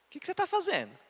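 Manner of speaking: high sneering voice from nowhere; mocking inner voice. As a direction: pitch way up and needle him. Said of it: "eu ouvi uma voz assim". (voz aguda e zombeteira)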